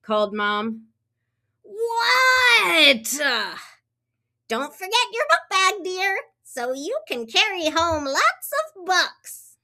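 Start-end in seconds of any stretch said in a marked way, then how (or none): 1.6-3.2 high, loud yell; yelled What. Acted. Froggy shouts back to Mom, high and loud. 4.5-9.6 high, fast motherly voice; Mom. Acted. Mom reminds Froggy about his book bag, high and quick.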